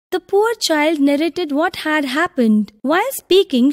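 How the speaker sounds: ordinary delivery, nothing marked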